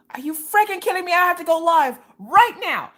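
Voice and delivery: high, loud mock outrage. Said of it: "You know how mad I got?".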